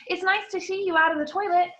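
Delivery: high forced cheer; strained cheerful compliment; falsely bright. Acted. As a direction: keep up fake brightness. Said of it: "in a falsely bright voice".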